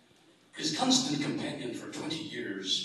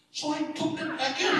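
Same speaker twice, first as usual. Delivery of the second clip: laughing punchline; 7 dB louder than usual. Acted.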